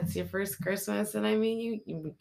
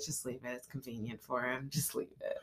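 softer, lower, muttering to herself; muttered resignation; 6 semitones lower, 11 dB quieter than usual. Retold or imitated. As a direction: let it go under your breath, softer. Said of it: "I'm just like".

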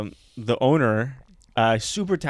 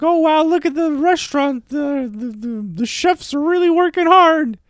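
high, louder put-on girly voice; mock woman's voice; 12 semitones higher, 4 dB louder than usual. Woman on a date praising the chefs, high mock girly voice.

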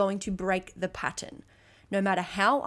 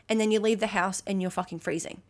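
normal talking voice

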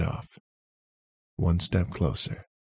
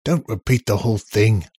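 louder, higher irritated snap; snapped; 5 semitones higher, 7 dB louder than usual. A narrator's reading. Bill stopping the robot repeating himself, snapped. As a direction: cut him off, irritated. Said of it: "Bill snapped".

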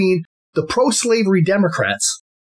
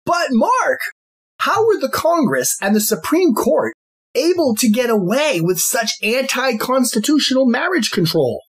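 higher-pitched voice of a puzzled listener; questioning listener; 7 semitones higher than usual. Acted. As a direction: ask it as an inquiring listener, pitched up. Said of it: "many attentive listeners here could be asking".

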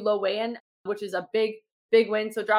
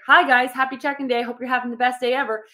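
upbeat greeting; 3 semitones higher, 5 dB louder than usual. Retold or imitated. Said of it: "you're not gonna get the same one that's like".

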